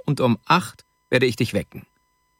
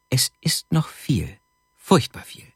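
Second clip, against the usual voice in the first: dismayed near-whisper; 2 semitones higher than usual. A narrator's reading. Dismayed answer about remaining work. (langsam, bestürzt, fast geflüstert)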